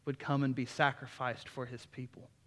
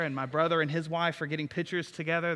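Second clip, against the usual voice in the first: normal talking voice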